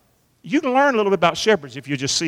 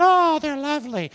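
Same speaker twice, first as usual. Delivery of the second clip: mock sentimental; 6 semitones higher than usual. Mocking starry-eyed shepherd romance.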